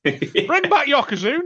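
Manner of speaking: high, loud jokey rallying cry; excited joke call. Real, not acted. Co-host joking about bigger tag teams, excited loud call. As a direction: shout it out like a fan's demand.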